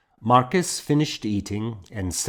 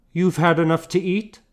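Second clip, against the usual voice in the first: higher, quicker question; a light touch of Morgan's voice; lightly voiced older woman; 9 semitones higher, 4 dB louder, faster than usual. A narrator's reading.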